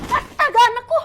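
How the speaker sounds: loud, high shocked scream; panicked mother shriek; horrified cry. Acted. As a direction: cry out in terror for her child. Mother sees child in danger.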